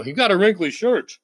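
lightly voiced sneering onlooker; a hint of a gossiping critic; mock judgmental onlooker. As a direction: imitate someone tutting at his shirt. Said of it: "I could just picture it".

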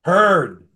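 "Heard" called out loud and firm; answering emphatically. Shown as a demonstration. Preacher answers his own question to the congregation, loud. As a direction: land the key word "Heard" like the answer to a quiz. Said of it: "What's the key word I'm looking for there?".

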